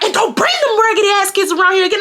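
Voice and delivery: fast, high mock yell; retold angry warning. Daughter warning off the lady, fast high yell. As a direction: rattle off a heated, high-pitched warning.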